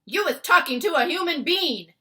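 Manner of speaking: very loud, high accusing shout; accusing boom. Acted. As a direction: catch him out with a roar. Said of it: "he boomed".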